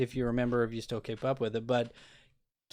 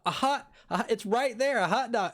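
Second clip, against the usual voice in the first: exasperated; 9 semitones higher than usual. Retold or imitated. Pleading with the clerk who stares blankly, exasperated. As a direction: pitch jumps higher, insistent and exasperated. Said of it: "they look at me blank".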